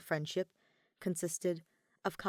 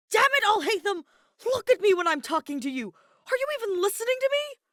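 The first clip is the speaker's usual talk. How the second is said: furious shout, much higher and louder; explosive yell; 10 dB louder than usual. A narrator's reading. Kaveh yelling at his silent roommate, furious shout. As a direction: explode at him, yelling with everything. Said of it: "and explosively yells".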